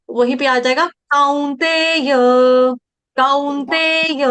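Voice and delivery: काउंतेय stretched with kau like cow; drawn out काउंतेय, kau as in cow; slow काउंतेय with a clear kau; slow pronunciation demo. Shown as a demonstration. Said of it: "काऊ।".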